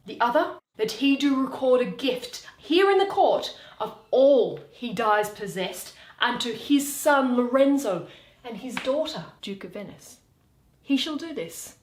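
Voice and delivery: Shakespeare read aloud, every part voiced in turn; theatrical reading of a courtroom scene; dramatic play reading. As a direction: read the scene like a stage performance. Reads all parts of the play aloud.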